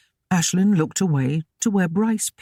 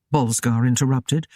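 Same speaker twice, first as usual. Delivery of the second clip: plain reading voice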